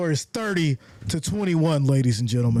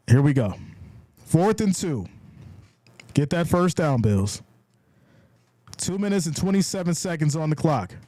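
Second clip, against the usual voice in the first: ordinary delivery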